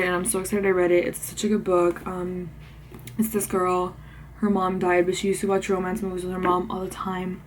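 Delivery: usual speaking voice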